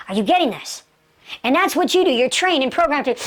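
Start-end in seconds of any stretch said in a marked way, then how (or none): none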